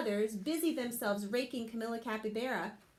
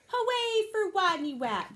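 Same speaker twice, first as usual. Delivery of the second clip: high, loud cheer; 7 semitones higher, 4 dB louder than usual. Acted. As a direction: cheer for the hero. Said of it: "they cried".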